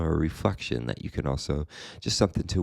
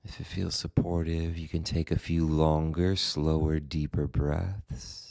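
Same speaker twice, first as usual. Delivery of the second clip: guided meditation; 3 dB quieter than usual. Acted. Meditation teacher guiding listeners into the body and breath, calm guided meditation. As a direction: guide them gently, leaving space after each phrase.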